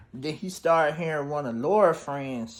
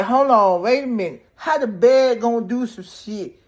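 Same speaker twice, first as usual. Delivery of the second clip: incredulous; 6 semitones higher, 4 dB louder than usual. Retold or imitated. Narrator disbelieving the bed killed.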